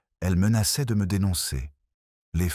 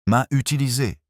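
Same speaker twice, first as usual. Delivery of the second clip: higher, louder startled echo; surprised; 8 semitones higher, 5 dB louder than usual. A narrator's reading.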